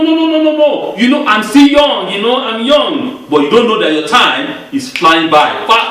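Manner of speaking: normal talking voice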